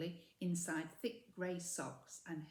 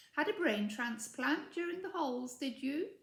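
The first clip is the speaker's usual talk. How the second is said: higher, louder, mocking schoolgirl sneer; sneery voice; 7 semitones higher, 5 dB louder than usual. A narrator's reading. Ethel mocking Mildred, sneery and higher. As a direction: jab at her with a sneer. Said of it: "said a sneery voice".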